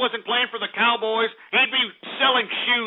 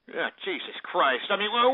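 ordinary talk, nothing marked

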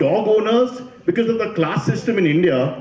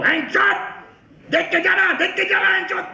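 high-pitched swearing yell imitating dad; dad yelling loudly. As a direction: imitate an angry father yelling from the car.

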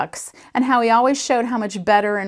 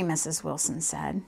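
ordinary delivery, nothing marked

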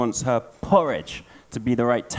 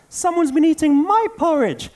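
very high, louder squeaky little bear; baby bear voice; 4 dB louder than usual. Acted. Baby bear wails his porridge is gone, high squeak. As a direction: squeak it out like a tiny cub. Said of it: "said the baby bear".

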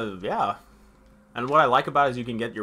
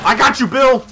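excited shout; 12 semitones higher, 12 dB louder than usual. Real, not acted. Calling to ally in fight.

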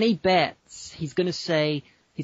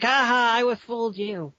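gleeful troll; 7 semitones higher than usual. Retold or imitated. Podcaster imitating trolling dad revealing prank, mock gleeful crow. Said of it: "he would be all like".

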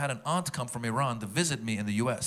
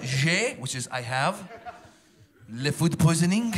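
broken French; 2 semitones higher, slower than usual. Retold or imitated. Sick tourist asking the pharmacist for medicine, broken French. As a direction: push le food poisoning out proudly. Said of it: "I said".

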